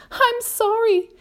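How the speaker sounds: high-pitched, helpless farewell; despairing cry. A narrator's reading. James says farewell as the peach falls, despairing. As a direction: say goodbye high, bracing for the end. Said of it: "cried James".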